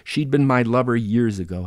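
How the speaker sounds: usual speaking voice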